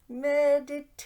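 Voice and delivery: announced loud and high; loud reveal. Pretend. Answering her own riddle, loud reveal. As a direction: belt out the word like a reveal.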